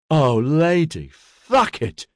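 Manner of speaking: slow drawn-out start, leaping high; exasperated groan. A narrator's reading. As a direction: groan at unwelcome bad luck. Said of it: "she groaned".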